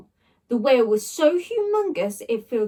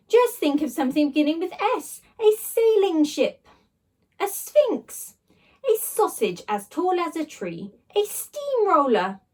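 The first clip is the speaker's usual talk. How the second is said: grand slime voice; 6 semitones higher than usual. Acted. Slime blob showing off its shapes, high and grand. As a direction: savour each wonder.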